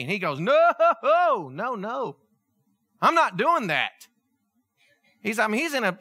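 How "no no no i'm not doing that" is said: drawn-out, high "no", then firm; mock horrified refusal, stretched out; emphatic refusal. Joseph turning down his master's wife, emphatic refusal.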